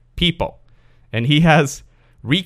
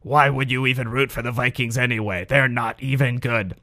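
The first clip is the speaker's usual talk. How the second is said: gravelly cartoon voice; 4 dB quieter than usual. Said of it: "sounded like a gravelly cartoon character".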